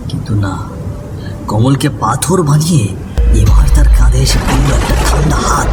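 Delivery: usual speaking voice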